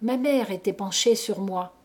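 plain reading voice

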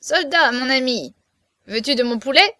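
lightly voiced sly little man calling out; small sharp voice teasing the giant; thin reedy little voice. A narrator's reading. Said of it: "il cria de sa petite voix aigrelette".

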